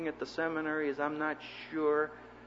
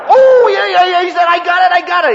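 eager shout; 18 dB louder than usual. Retold or imitated. Professor eager to finish joke.

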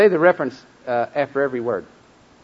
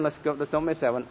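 normal talking voice

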